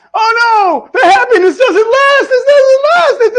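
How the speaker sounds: high, hammed-up crying wail; mock sob, high and laughing; mock despair. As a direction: wail it like melodramatic doom. Mock despair at happiness ending.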